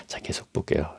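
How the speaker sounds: soft whispered aside. Real, not acted. Aside to the congregation.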